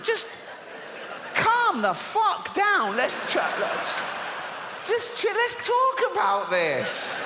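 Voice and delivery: exasperated plea. Real, not acted. Pleading with God mid-rant.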